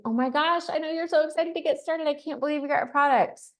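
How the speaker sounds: high, fast, bubbly excited voice; gushing excitement. Retold or imitated. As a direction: gush like a thrilled friend on the phone. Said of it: "It can be".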